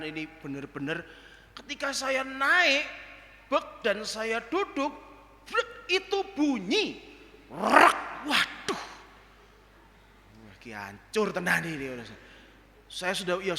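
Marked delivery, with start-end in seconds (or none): 5.5-8.0 loud slow crunch sound; the old motorbike groaning under the speaker; imitated crack. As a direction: mimic the bike cracking under you. Motorbike creaks under weight.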